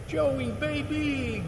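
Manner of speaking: put-on high, slow, drawn-out "Joey, baby"; conductor's greeting. Conductor greeting his famous passenger on the train, high and drawn out. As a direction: croon it like an old friend hamming it up. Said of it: "he goes".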